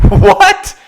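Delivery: loud, high-pitched disbelief, retold; incredulous high outburst at dad; mock incredulity. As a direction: act out an outraged "are you serious". Retelling disbelief at dad's casual call.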